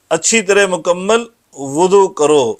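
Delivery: normal talking voice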